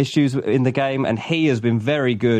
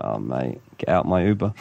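low mumbled footballer; 9 semitones lower than usual. Retold or imitated. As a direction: drop the voice low and mutter. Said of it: "he'd be like".

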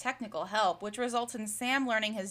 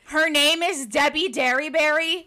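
loud, higher-pitched disbelief; incredulous; 5 semitones higher, 8 dB louder than usual. Real, not acted. Co-host reacting to the actress's name, incredulous. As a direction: blurt it out as an astonished question.

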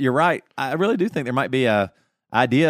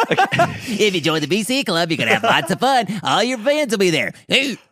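ad announcer voice; 6 semitones higher than usual. Pretend. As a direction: ham up a radio commercial. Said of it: "I'm doing an audition".

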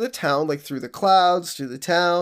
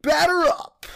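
umpire call; 13 semitones higher than usual. Acted. Podcast intro bit, umpire call.

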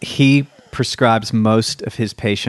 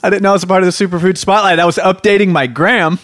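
excited protest; 8 semitones higher, 4 dB louder than usual. Real, not acted. Protests being dragged in.

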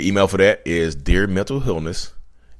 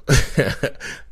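breathy laugh; 8 semitones higher, 4 dB louder than usual. Real, not acted. Laughing at robot heart joke.

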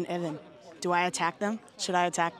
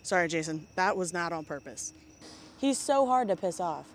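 ordinary delivery

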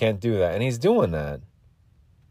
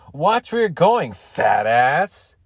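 mock taunt; 5 semitones higher, much slower than usual. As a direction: sing out a schoolyard insult. Retold taunt, repeated for laughs.

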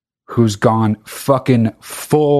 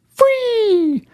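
squeaky sales pitch. Acted. Merch plug, sudden high voice.

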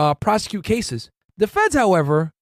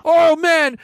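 startled; 10 semitones higher, 5 dB louder than usual. Retold or imitated. Startled by FBI knock.

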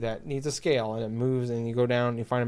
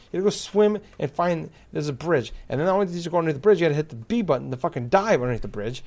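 normal talking voice

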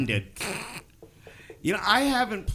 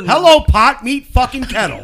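1 loud mock retort; 8 semitones higher, 13 dB louder than usual. Real, not acted. Mocking friend's hypocrisy, loud.

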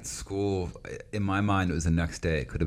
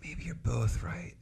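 soft musing; 3 semitones higher, 12 dB quieter than usual. Retold or imitated. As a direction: murmur it as if it just dawned. Quiet musing realization.

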